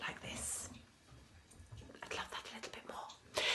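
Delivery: very soft, whispered request; hushed hint. Acted. Partner demonstrating the bath mat in a video message, whispered. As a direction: drop to a whisper for the gentle nag.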